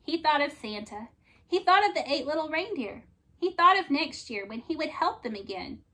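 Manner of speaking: usual speaking voice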